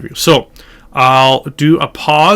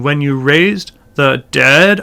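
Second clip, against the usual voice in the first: slow, lighter stress on "raised", stronger on "dead"; slower than usual. Shown as a demonstration. Teacher placing words on notes, slowly stressing "dead". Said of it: "almost like a sub-emphasis".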